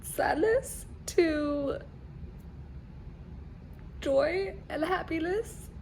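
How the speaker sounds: barely holding back tears; tearful. Real, not acted. Reader after an emotional chapter, teary and soft. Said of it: "From tears of pure sadness".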